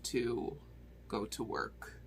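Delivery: whispered aside. Real, not acted. Reviewer noting she must leave for work, whispered aside. Running late, trails off.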